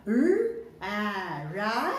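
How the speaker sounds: each sound said separately, then blended into Ra; sounding out letters. Shown as a demonstration. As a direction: sound out R, then A, then join them. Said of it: "The first sound is".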